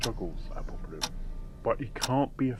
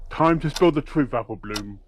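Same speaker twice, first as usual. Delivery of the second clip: raised, forceful interrogation; firm demand; 3 semitones higher, 7 dB louder than usual. Acted. Detective demanding the truth from Applebloom, forceful. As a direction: press her hard for the truth.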